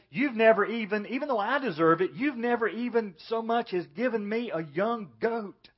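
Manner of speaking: put-on resentful older son venting his grievance; angry complaint. Older brother angrily protesting to his father. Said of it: "And so the brother is mad".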